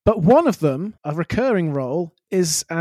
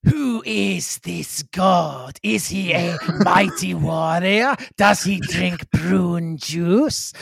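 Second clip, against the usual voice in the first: very slow, ponderous alien warrior voice; Iraxa; much slower than usual. Acted. Alien asking the British about God, slow character voice. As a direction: draw out each word like a puzzled alien ruler. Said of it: "wouldn't Iraxa have then asked".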